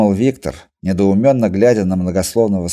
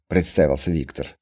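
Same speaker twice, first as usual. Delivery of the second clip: plain reading voice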